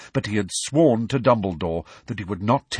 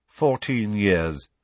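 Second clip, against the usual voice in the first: slow flat reply; slower than usual. Acted. Snape voiced, slow reply.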